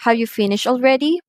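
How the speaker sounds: quick, high question climbing on "already"; rising intonation. Shown as a demonstration. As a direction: lift "already" right up at the end. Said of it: "are going up".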